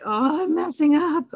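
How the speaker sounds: high, loud and slow panicked cry; panicked worry. Retold or imitated. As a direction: play a mom sure she's ruined it. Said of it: "you might be like".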